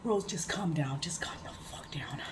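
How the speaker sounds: hushed self-soothing whisper. Real, not acted. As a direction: whisper it to yourself to cool down. Calming herself down, to herself.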